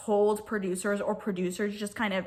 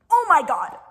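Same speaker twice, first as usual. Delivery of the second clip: exasperated exclamation; 5 semitones higher, 8 dB louder than usual. Real, not acted. Reacting to messy finale.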